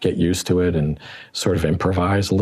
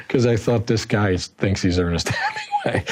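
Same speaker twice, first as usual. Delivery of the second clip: laughing between the words; amused laughter; 5 semitones higher than usual. Real, not acted. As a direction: break up laughing as you tell it. Amused at character's self-image.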